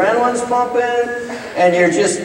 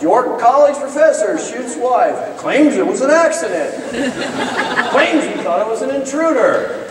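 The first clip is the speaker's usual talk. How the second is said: lightly voiced news headline, higher; mock headline; 7 semitones higher than usual. Professor imagining the headlines, mock news read. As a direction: read it like a grim newspaper banner, half laughing. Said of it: "I was reading the headlines".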